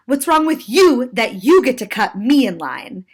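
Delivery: loud, accusing mock yell with punched words; imitated yell. Woman confronts pre-boarder about cutting, imitated yell. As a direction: demand it like an affronted passenger. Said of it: "And again she yelled".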